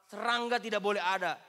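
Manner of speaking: usual speaking voice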